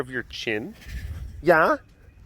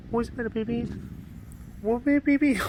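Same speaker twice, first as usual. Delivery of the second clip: pet talk. Real, not acted. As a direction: fuss over a cat in a tiny high voice. Fussing over a startled cat.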